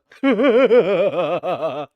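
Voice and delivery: loud strange cackle; weird laugh. Shown as a demonstration. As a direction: laugh in a messed up way. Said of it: "I gotta laugh, like, fucked up".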